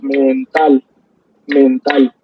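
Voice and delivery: "mental" stretched slowly, men-TAL, as if clapping it out; syllable-by-syllable demo. Shown as a demonstration. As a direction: draw out "mental" and land on the last syllable. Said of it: "me lo habían enseñado con aplausos". ("mental" alargado por sílabas, marcando -TAL)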